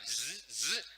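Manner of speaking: two drawn-out "zzz" noises; imitated car scanner buzz. Speaker mimics the fantastic car's noise, buzzing zzz. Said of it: "es como el coche fantástico, que hacía".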